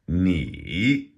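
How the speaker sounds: NI dipping down, then swinging up; third tone. Shown as a demonstration. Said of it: "When two third tones come together".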